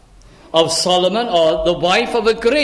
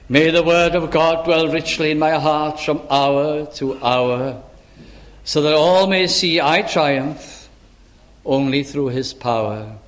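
measured, low hymn verse recitation; recited prayer; 3 semitones lower than usual. Acted. Preacher closing his sermon with a hymn, prayerful recitation. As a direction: recite it line by line, with pauses and falling endings.

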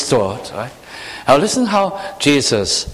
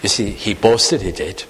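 ordinary talk, nothing marked

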